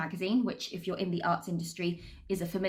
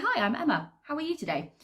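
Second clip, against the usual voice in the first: bright, high, friendly greeting; chirpy host greeting a guest; upbeat cruise director; 7 semitones higher than usual. Retold or imitated.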